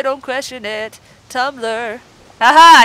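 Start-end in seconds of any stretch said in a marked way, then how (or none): 2.4-2.8 loud triumphant aha; real burst of triumph; excited aha. Real, not acted. Finally making something happen, a loud excited aha. Finally gets the game working.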